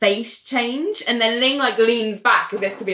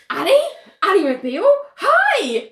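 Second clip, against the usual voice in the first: high-pitched mock fan greeting; rising recognition into a squealed hello; really excited; 11 semitones higher, 3 dB louder than usual. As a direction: imitate an excited stranger rushing up. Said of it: "Like, really excited".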